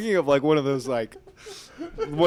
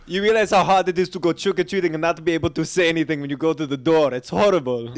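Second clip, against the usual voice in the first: quick, badly faked French accent; wobbly mock-French accent; 4 dB louder than usual. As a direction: complain in a muddled French accent. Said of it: "That's my French accent, I guess".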